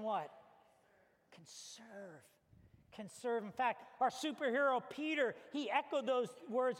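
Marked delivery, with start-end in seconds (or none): 1.3-2.2 very soft, almost whispered prompt; quiet, weighty reveal; hushed answer. Real, not acted. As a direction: drop right down to a murmur.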